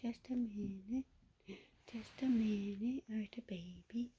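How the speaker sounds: soft unvoiced whisper. Real, not acted. Whispering to the kittens to wait a minute. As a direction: hush it so the kittens stay calm.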